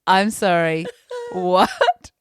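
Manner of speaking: retold outrage, half laughing; incredulous. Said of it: "I'm like".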